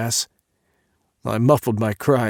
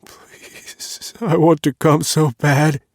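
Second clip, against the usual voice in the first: begging; 5 semitones higher, 6 dB louder, slower than usual. A narrator's reading. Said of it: "I begged him".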